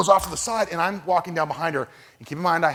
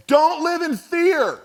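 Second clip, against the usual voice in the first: high, slow call after a runner; a pastor calling after a jogger, high and slow; retold angry call; 6 dB louder, much slower than usual. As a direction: fling the words after her, high and drawn out. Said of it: "and I am just angry".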